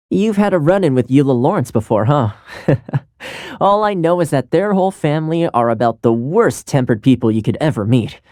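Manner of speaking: normal talking voice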